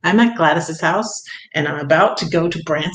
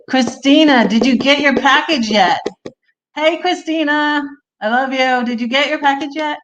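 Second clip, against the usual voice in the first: higher, bright call across the chat; calling out to a friend; 8 semitones higher than usual. Real, not acted. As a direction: hail Christina eagerly, much higher.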